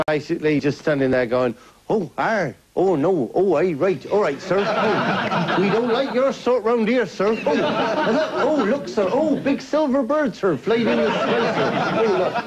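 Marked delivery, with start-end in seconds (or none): 1.9-12.5 high, swooping mock-Cornish yokel; Cornish yokel impression. Comedian sends up suspicious Cornish locals in a high mock-Cornish accent. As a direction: put on a broad, gawping Cornish accent. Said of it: "it's impressions of Cornwall".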